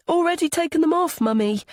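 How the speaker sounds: put-on high, quick child's voice; little boy. A narrator's reading. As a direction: answer Mummy like a hurried little boy. Young son answers his mother.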